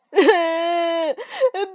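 loud wailing sob; a sudden falling wail of tears; sobbing burst. Acted. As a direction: break into loud crying. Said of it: "跳跳放声大哭起来".

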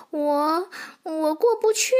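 high, hesitant foal voice; embarrassed little horse. Acted. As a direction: stammer it out sheepishly, high-pitched. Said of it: "小马难为情地说". (小马难为情、吞吞吐吐地说)

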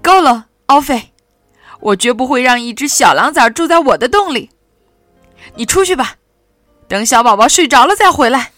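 loud angry mother bear, fast; near roar. Acted. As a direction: scold the cub hard and fast. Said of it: "她几乎是在咆哮".

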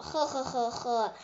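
acted menacing laugh, "he he he he"; villainous chuckle. Tyrannosaur gloats over the baby he will eat, villainous chuckle.

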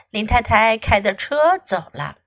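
ordinary delivery, nothing marked

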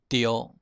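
丢 as "tiō", ending in o; Hokkien reading. Shown as a demonstration. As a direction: say 丢 as Hokkien "tio". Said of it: "赵呢念成丢".

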